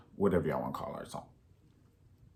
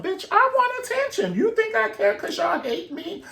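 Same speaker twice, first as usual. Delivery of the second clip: high shrill-free mock diva voice, much higher. Comic mocking a fame-seeking star, high mock diva. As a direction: play the attention-hungry star squealing.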